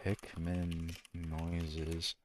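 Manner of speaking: very slow, very soft creature squeak; Pikmin noise. Shown as a demonstration. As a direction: stretch it out like a tiny Pikmin. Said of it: "I can make Pikmin noises".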